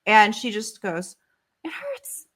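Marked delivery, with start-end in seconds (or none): 1.6-2.3 high, breathless whimpering whisper; a hurt little girl whispering in pain; hurt child whisper. Retold or imitated. Injured daughter quoted, whispered.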